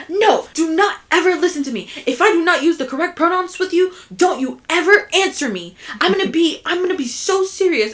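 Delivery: an ex-boss mimicked pleading earnestly; imitating the boss's plea. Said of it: "she was like".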